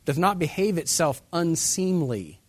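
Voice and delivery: loud, slow King James line; a preacher's booming reading; scripture proclamation. Shown as a demonstration. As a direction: stretch out each word of the verse. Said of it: "reading scripture aloud with force".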